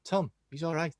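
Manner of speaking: put-on rough stab at a Scouse accent; Scouse attempt. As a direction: imitate a Liverpudlian, not very well. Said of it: "I can't do Scouse accents".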